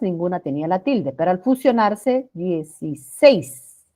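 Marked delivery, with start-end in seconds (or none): 2.3-3.9 dieciséis slow, syllable by syllable, landing on SÉIS; sílaba tónica marcada. Shown as a demonstration. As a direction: draw out dieciséis to show the stressed last syllable. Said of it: "Ahí está la sílaba tónica". (dieciséis lento, separando sílabas y marcando SÉIS)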